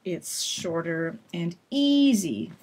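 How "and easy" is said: "easy" drawn out slowly on its first vowel; slow, stretched "easy" for students. Shown as a demonstration. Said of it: "is longer and louder".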